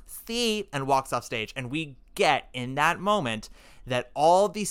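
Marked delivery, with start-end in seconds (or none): 0.1-0.7 Maria. Retold or imitated. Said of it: "Accent is back".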